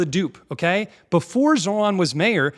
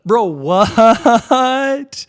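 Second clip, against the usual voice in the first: loud, high disbelieving cry; incredulous outburst; 6 semitones higher, 8 dB louder, much slower than usual. Real, not acted. Incredulous reaction to clip.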